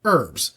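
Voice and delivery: "herbs" said as "erbs", H dropped; silent H. Shown as a demonstration. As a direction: leave the H off "herbs". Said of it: "a guy named Herb, and he sells".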